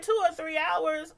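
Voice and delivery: high-pitched incredulous echo; retold disbelief. Stranded driver questioning officer's wait estimate, incredulous. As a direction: repeat it back in disbelief.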